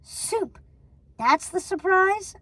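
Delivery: high, mocking screech. A narrator's reading. Martha mocking Gothel's soup, screeching. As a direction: scoff in disbelief. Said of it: "screeched Martha".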